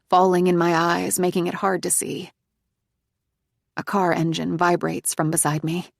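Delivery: ordinary delivery, nothing marked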